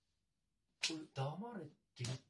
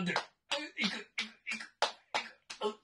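climax; 11 semitones higher, 4 dB louder, faster than usual. Acted. Climax of the scene.

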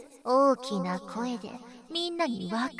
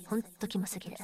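softer whispered revulsion; disgusted whisper; 3 semitones lower, 7 dB quieter, faster than usual. Acted. Muttering contempt at the listener, whispered. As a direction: hiss it under your breath, repulsed. Aside of disgust while scolding.

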